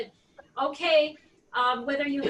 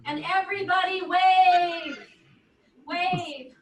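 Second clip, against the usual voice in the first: loud call to a distant room; yelling across the room; 4 semitones higher, 6 dB louder, slower than usual. Real, not acted. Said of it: "I'm gonna yell across the room".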